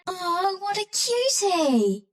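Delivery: high, slow coo of delight; high-pitched adoring voice. Acted. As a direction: gush over something adorable, drawing it out. Cooing over something cute.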